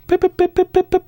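short, high-pitched boops; imitated beeps. Host scrolling back to cartridges, high playful boop noises. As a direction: beep along in little even blips.

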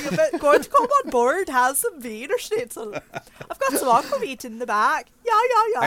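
put-on jokey Swedish-ish old lady; mock Swedish. Player riffing on the granny, in a hammed-up Swedish accent. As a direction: ham up a Swedish chef lilt. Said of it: "Just make her Swedish chef".

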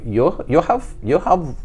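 a hint of a Filipino accent, imitating her; mild Filipino accent impression; Filipino accent imitation. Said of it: "She was from the Philippines, so that's why the accent".

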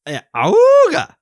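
loud high ahooga honk; ahooga horn. Pretend. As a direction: blast it like a klaxon. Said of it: "I'll do an alarm sound".

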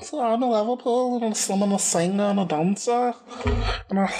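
high, ditzy Liverpool accent impression; mock Scouse accent. Host mimics the footballer's Liverpool accent, high and ditzy. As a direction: lilt it up like a giddy Liverpool girl. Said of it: "that's really what she sound like".

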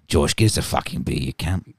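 low, matey broad Aussie; Laura's Australian accent. Acted. Co-host as Laura ordering Josh about, low Australian accent. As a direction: demand it in a put-on Australian voice. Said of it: "sounds just like Laura".

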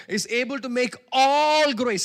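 slow, loud, ringing preacher proclamation; preaching at full force. Acted. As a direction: stretch the verse out loud like a pulpit cry.

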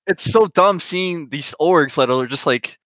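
exasperated rant, pitch climbing high; pissed off. Real, not acted. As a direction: vent in high-pitched, incredulous annoyance. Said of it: "kinda pissed off about that".

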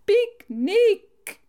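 high, loud shrill mimicry; imitating a shrill, sing-out shout; shrill call. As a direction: call guests to lunch in a piercing voice. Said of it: "een schelle stem, riep ze".

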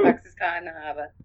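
broad Boston vowels, light touch; Boston accent. Retold or imitated. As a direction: drop the r's, Boston style.

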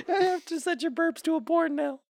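high-pitched words through laughter; laughing. Real, not acted. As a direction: squeeze it out while cracking up. Laughing fit.

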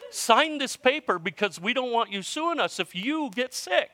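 hammed-up young guy reading his script; young clerk imitation. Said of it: "he goes".

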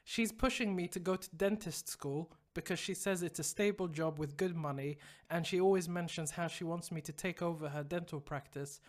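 low, subdued letter-reading voice; reading email aloud. A narrator's reading. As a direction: read the listener's problem out evenly. Reads listener email aloud.

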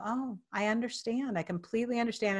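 soft, soothing retold reply; softly acted understanding; calm. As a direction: acknowledge gently, voice hushed. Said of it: "I just got calmer and calmer".

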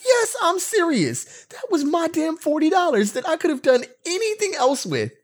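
mock outraged retelling to wife; mock rant. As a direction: whine about the lost money in a comic voice. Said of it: "I'm like".

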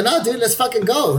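pumped reply. Retold or imitated. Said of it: "I go".

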